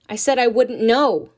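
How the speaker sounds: loud, sharp repetition; defiant repeat. Acted. Nina repeating herself to Ma, loud and defiant. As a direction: throw it back at her.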